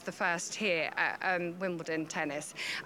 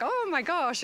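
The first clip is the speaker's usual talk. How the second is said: higher-pitched imitation of startled onlookers; mock shock; 8 semitones higher than usual. Handler imitating players bumping into the hawk, mock shocked. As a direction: act out being taken aback. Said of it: "everyone's really either shocked".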